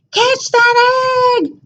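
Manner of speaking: high, slow call of alarm; cow crying out. A narrator's reading. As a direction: cry out as the egg rolls away. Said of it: "the cow cried out".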